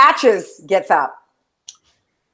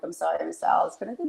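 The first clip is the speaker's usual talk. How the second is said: timid request; 4 semitones lower, 10 dB quieter than usual. Retold or imitated. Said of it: "I was like".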